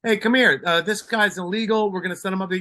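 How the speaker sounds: a lightly voiced brash boat owner; voicing the boss. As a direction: call out like an owner who owns the place. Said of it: "He goes".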